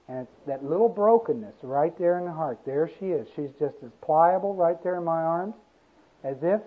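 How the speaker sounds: ordinary talk, nothing marked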